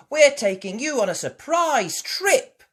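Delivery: put-on high, loud child's announcement; excited cry. Child announcing a surprise trip, crying out. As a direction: call out the news with glee. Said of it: "cried Peppa".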